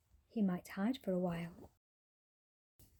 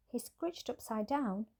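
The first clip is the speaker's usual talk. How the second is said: ordinary delivery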